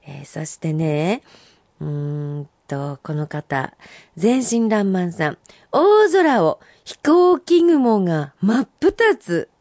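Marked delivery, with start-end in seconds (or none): 5.7-9.5 slow, higher poem reading; haiku recital. Acted. Host reciting a listener's haiku on radio, slow. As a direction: savour each phrase slowly.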